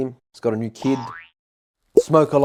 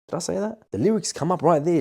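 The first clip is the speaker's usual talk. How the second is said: ordinary talk, nothing marked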